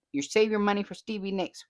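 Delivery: ordinary talk, nothing marked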